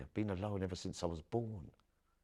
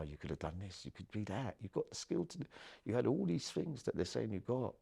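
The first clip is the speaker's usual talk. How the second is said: imitated encouragement; 7 semitones higher than usual. Retells others' urging encouragement.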